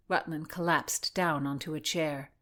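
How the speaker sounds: ordinary delivery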